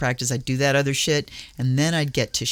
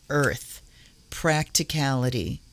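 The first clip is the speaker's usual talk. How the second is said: ordinary talk, nothing marked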